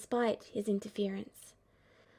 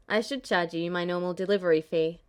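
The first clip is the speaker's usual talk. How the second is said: put-on lower, dry young man; dry Cloud voice; 4 semitones lower, 4 dB louder than usual. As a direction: say it dryly.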